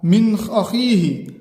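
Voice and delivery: very slow, clear नून before हमजा; slow clear reading of मिन अखीही; izhar recitation. Shown as a demonstration. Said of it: "तो इजहार".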